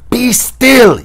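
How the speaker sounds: loud, high firm order; urgent shouted command; Inigo commanding. A narrator's reading. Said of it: "Inigo commanded".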